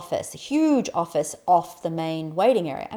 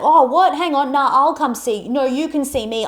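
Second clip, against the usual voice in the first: loud, high mock bellow at the patients; hammed-up boss yelling from his door; imitated bellow; 6 semitones higher, 6 dB louder than usual. Angry boss yelling at her waiting clients, loud mock bellow. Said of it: "he bellowed, he yelled".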